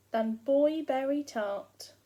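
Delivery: usual speaking voice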